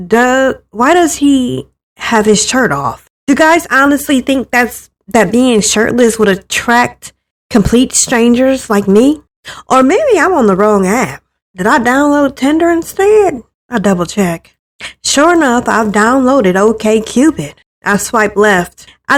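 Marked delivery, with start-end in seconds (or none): none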